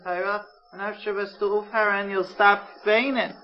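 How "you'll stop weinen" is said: usual speaking voice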